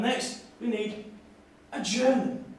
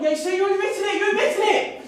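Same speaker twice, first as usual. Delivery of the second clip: higher, louder gotcha cry; triumphant accusation; 12 semitones higher, 6 dB louder than usual. Acted. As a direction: pounce on the admission with glee. Accusing audience member triumphantly.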